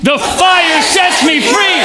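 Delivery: high, drawn-out shout; shouted crowd chant; Braveheart rallying cry. Shown as a demonstration. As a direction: rip it like a battle cry. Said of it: "really rip it like you're".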